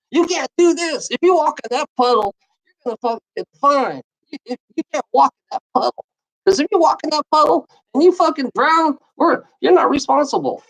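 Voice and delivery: high, fast, lightly sing-song mock scolding; a hint of a shrill scold, rattled off fast; mocking the rule-makers. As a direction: parody the nagging lawmakers. Mocking the lawmakers' rules.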